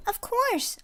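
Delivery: put-on high excited kid shout; child shouting. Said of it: "another child shouted".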